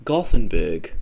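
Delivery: "Gothenburg" loud and low, GOL-then-berg; attempted Swedish pronunciation. Shown as a demonstration. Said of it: "pronounce that in the right Swedish pronunciation".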